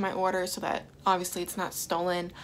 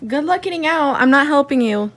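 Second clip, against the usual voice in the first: loud, higher taunt; teasing call; 4 semitones higher, 12 dB louder than usual. Real, not acted. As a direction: call it out to the one in the box. Teasing someone stuck in box.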